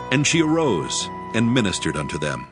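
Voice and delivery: normal talking voice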